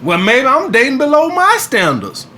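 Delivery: smug inner thought. Retold or imitated. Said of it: "I start to look at them a little bit like".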